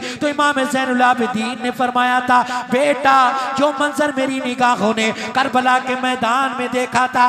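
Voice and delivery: loud, driving preacher's litany; impassioned preaching. Acted. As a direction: build the list with rising fervour. Fervent sermon list of weeping.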